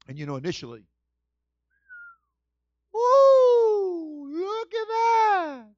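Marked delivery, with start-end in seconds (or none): 2.9-5.7 very high, slow, wondering voice; high dazzled Eve voice; awestruck Eve. Pretend. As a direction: gasp over the fruit like a dazzled girl. Eve admiring forbidden fruit.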